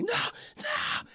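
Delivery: very high, unvoiced breathy squeal; mock shriek retelling the fight. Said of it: "I'm shrieking".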